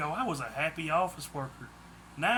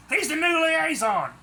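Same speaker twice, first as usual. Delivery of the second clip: higher, louder furious snap; snapped angrily; 11 semitones higher, 6 dB louder than usual. A narrator's reading. Daisy snaps at the kidnappers, angry and loud. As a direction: bite the words out at them. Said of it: "Daisy snapped angrily".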